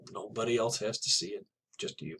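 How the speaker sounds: ordinary talk, nothing marked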